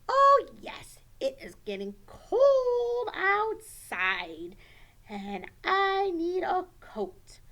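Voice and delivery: put-on high, loud, drawn-out puppet child; exaggerated slow, high kid voice; child character. Johnny answers that he needs a coat, in a big child voice.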